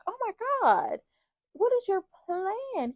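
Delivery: high, drawn-out mock dismay; mock exasperation. Woman reacting to his casual plan to stay, mock exasperated. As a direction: act out a high-pitched, incredulous reaction.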